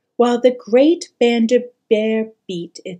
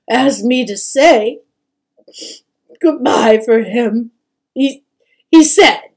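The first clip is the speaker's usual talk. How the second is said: loud, halting, tearful goodbye; mother breaking down mid-message; overwhelmed with sadness; 3 semitones higher, 11 dB louder than usual. A narrator's reading. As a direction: choke up and stumble to a stop. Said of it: "overwhelmed with sadness".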